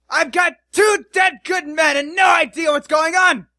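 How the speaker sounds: furious dwarf bellowing, high and loud; angry dwarf captain. Acted. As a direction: roar like an outraged dwarf. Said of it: "lower your voice".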